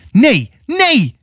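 high, desperate shriek of refusal; screaming. A narrator's reading. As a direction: scream no while fighting off attackers. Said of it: "Ze gilde". (hoog gegild, wanhopig)

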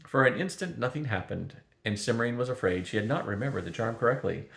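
plain reading voice

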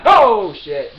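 sudden loud jolt of fright; real startled yelp. Real, not acted. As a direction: jump and blurt it out, caught off guard. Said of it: "You scared the hell out of me".

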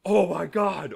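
loud, high mock oh my God; a loud, high imitated exclamation; retold shocked gasp. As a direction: act out his horrified surprise. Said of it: "woke up to him saying".

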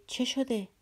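Alyosha, taken aback, asks what happened, startled; startled question. A narrator's reading. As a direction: pitch jumps up, startled. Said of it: "آلیوشا یکه خورد".

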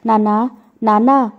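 put-on children hesitantly calling their father, understated; mildly frightened, tentative call; timid fearful call. A narrator's reading. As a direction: call out to father with a touch of fear. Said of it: "భయంతో మెల్లగా పిలిచారు".

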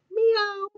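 a bright, high little meow; high cat meow. Acted. Storyteller voicing the cat's surprised meow, high and bright.

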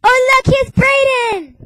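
very high, loud and slow; excited. Real, not acted. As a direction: announce it with big excitement. Excited introduction.

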